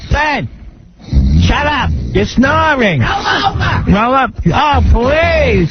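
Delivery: high, exasperated mother's whine, lightly mocked; nagging mom. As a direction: whine at the snoring husband in a high voice. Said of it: "I'd hear".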